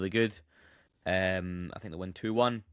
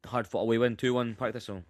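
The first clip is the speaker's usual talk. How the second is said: ordinary delivery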